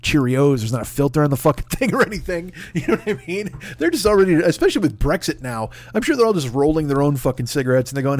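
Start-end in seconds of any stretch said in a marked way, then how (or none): none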